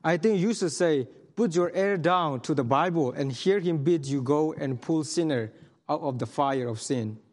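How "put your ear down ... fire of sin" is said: ordinary talk, nothing marked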